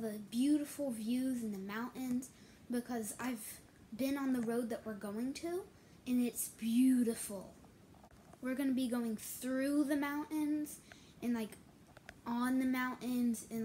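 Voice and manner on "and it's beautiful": whispering. Real, not acted. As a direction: whisper it, slowly and in awe. Whispering about beautiful road.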